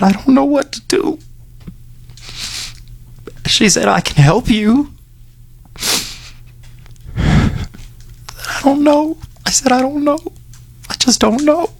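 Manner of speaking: high, slow and choked up; fighting back tears on the words; voice breaking. Real, not acted. Host reliving telling Tracy he was lost, near tears. Retelling moment he was helped.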